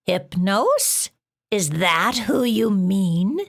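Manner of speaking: slow, stern, scratchy monster voice. A narrator's reading. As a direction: press her coldly like a scratchy-voiced beast. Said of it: "in her stern and scratchy voice".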